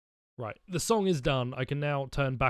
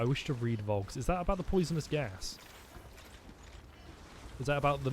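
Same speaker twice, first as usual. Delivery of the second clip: normal talking voice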